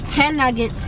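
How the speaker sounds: ordinary talk, nothing marked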